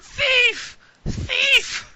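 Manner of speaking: put-on high, loud shrieking old woman; screeching old crone crying thief; old woman yelling. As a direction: shriek it like a robbed old lady. Old woman shouting after thief.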